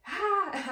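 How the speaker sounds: short high-pitched shriek; a bright, loud scream, high up. Shown as a demonstration. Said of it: "I'm not screaming like".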